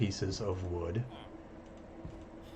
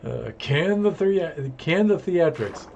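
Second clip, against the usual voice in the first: mock dramatic; 8 semitones higher, 6 dB louder, slower than usual. Mocking his own drama.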